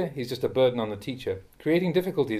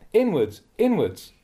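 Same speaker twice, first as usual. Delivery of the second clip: mock shout, louder and higher; retold shout; 5 semitones higher, 4 dB louder, slower than usual. Teacher shouting to monks who won't sweep inwards, retold shout. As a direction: call out an order as if to inattentive sweepers. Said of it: "I have to stand there and shout".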